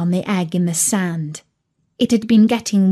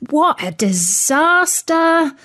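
higher, drawn-out lament; despairing; 7 semitones higher than usual. Acted. Dog despairing over the baby turkey, despairing. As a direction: wail it slowly in mock despair.

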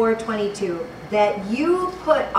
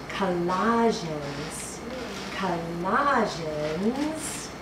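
slow, low, soft stretched word with a rising end; searching aloud; 5 semitones lower, 8 dB quieter, much slower than usual. Real, not acted. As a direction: draw it out while hunting for the reference. Preacher searching for the right book.

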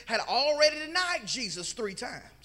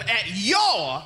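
"your" loud with a swooping rise and fall; emphasis on YOUR; 4 semitones lower, 9 dB louder than usual. Shown as a demonstration. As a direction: hit "your" hard and stretch it. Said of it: "put some emphasis on that word".

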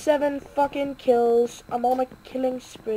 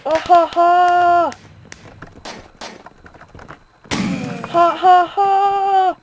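loud high slow cackling; triumphant laugh; 5 semitones higher, 7 dB louder, much slower than usual. Real, not acted. Gamer laughing loudly after a kill. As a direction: laugh at your win.

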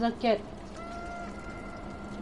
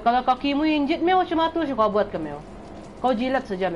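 ordinary delivery

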